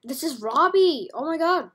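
loud, high, thrilled cry; excited discovery. Real, not acted. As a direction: exclaim it like a fan spotting a favorite. Finds the original Robbie, exclaims.

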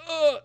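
sudden high, loud grunt; imitated grunt of exertion; effortful grunt. Retelling a hatchet that won't come out, high loud grunt. Straining to free stuck hatchet.

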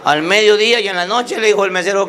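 normal talking voice

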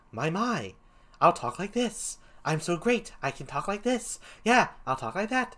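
lilting, self-pleased clown; first clown voice. Shown as a demonstration. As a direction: preen as a vain little clown. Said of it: "First clown, excuse me, will be like".

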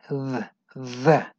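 the with a buzzing voiced th. Shown as a demonstration. Teacher drills the voiced th in the. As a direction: vibrate the throat on the th of the. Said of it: "tienes que vibrarla y decir".

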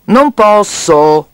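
loud, very drawn-out refusal; angry. A narrator's reading. Piggy bank refuses to return coins, angry. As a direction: refuse crossly, stretching each word. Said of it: "disse lui arrabbiato".

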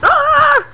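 high, loud yelp; startled yelp. Real, not acted. Player caught out mid-game, startled yelp. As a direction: cry out high and sudden. Startled cry during play.

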